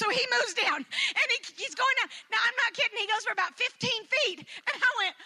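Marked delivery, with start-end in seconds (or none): none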